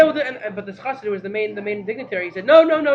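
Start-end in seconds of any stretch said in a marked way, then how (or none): 2.4-2.9 loud, emphatic no no no; firm refusal. Retold or imitated. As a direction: slam the door on the request. Dignitary flatly refuses Rebbe.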